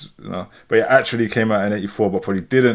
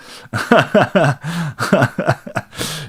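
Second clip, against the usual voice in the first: long burst of amused laughter; helpless reaction laugh; real laughter; 6 semitones higher than usual. Real, not acted. Reacting to a viewer's comment.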